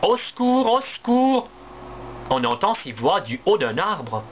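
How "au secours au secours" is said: high, loud little cries; cry for help. Acted. Trapped baby squirrel calls for help from the bush, high and loud. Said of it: "Deux autres voix crient".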